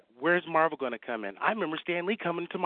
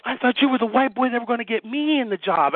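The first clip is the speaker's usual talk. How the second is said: higher, louder animated rush; hammed-up excitement; excited retelling; 7 semitones higher, 8 dB louder than usual. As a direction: assistant admitting his hopes, excited. Retold guy's excited confession.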